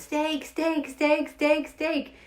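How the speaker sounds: high "steak" repeated like an echo; imitated echo. Mother's answer echoing through the neighborhood, imitated echo. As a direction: bounce the word "steak" down the street. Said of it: "it would echo through the whole neighborhood".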